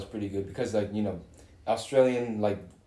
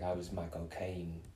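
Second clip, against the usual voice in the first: a hint of a Cockney film-star impression; Michael Caine; 5 semitones lower, 12 dB quieter than usual. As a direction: lightly imitate his London accent. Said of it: "I like to talk like".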